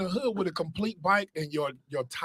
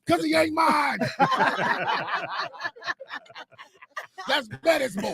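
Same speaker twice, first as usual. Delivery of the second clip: mock dad shout; 10 semitones higher than usual. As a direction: shout it like a caught-out stepdad. Playing the dad, mock reply.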